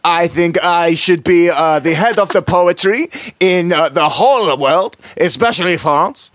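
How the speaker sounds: self-important poet in a put-on French accent. Shown as a demonstration. Artist poet interviewed on radio, comic French accent. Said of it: "the voice that you did".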